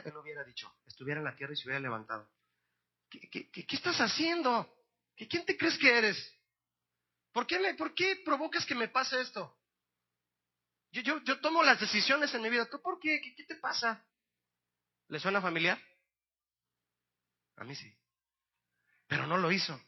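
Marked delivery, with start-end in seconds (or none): 3.1-14.0 high, stammering mock outrage; proud indignation. Proud Paul challenging God from the ground, indignant stammer. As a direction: sputter affronted questions in a raised pitch. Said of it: "si él hubiera estado orgulloso todavía".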